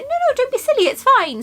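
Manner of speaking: high, bright insistence; insistent reassurance. Retold or imitated. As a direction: wave his objection away. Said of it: "I was like".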